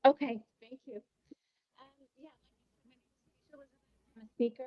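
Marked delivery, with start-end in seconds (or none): 1.8-4.3 faint, hard to hear; barely audible. Real, not acted. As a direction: voice fading out on the line. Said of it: "I think your voice is very low".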